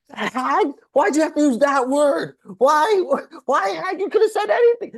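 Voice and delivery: high, loud, whiny indignation; mock outraged rabbi. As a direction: parody a shrill offended man, building up. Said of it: "He's like".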